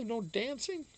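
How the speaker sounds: mock shocked question. Streamer reading a chat message, mock scandalised. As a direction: act scandalised that there's no dancing. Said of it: "Damn, Lady Di".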